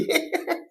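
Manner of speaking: high, airy burst of laughter. Real, not acted. Streamer amused by a rude comment, high airy laugh. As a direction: crack up after reading aloud.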